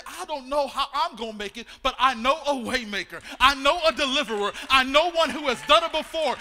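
building to a loud declaration; a believer answers doubters with a loud declaration of faith; declaring faith. Retold or imitated. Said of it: "you can say".

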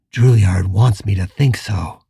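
ordinary delivery, nothing marked

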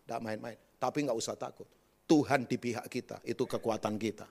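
ordinary delivery

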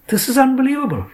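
stunned, throw the line up high; disbelief. A narrator's reading. Ahalya reacting to Sridhar's proposal, stunned disbelief.

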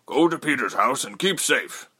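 slightly high, earnest father's voice; Jonan, urgent. A narrator's reading. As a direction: urge your daughter to safety. Father urges daughter to safety.

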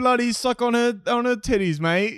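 hammed-up Aussie mate; mock Australian accent. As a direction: play at scolding in an Australian accent. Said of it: "I wish I could talk like that".

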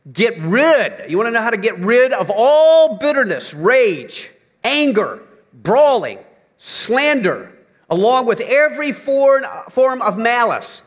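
high, loud scripture reading; fervent pulpit reading; preaching at full force. Acted. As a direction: hammer each sin in the list.

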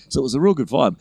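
normal talking voice

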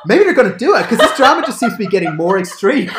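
high, loud, laughing through the words; half-joking alarm while cracking up; laughing disbelief. Retold or imitated. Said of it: "And I was like".

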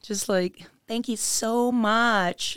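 high-pitched mock gushing; fake-nice American. Mimicking fake people thanking you so much, gushy high voice. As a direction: lay on the phony sweetness. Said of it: "a lot of fake people".

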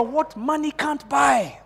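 high, drawn-out call at normal volume; preacher's call line. Shown as a demonstration. Preacher leading congregation in a phrase, high slow call. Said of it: "say it like a preacher".

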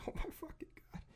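breathless high whisper of disbelief. Retold or imitated. Telling friends about a bank client, whispered disbelief. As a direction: whisper it under your breath, stunned. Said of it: "And I'm like".

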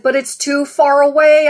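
high, loud panicked protest; panicky. Retold or imitated. Dreamer answering the voice in panic, high and loud. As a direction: blurt it out in a retold panic. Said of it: "And I felt panicky".

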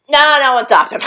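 laughing through the words; can't keep a straight face. Real, not acted. Telling friend the grim scene, laughing.

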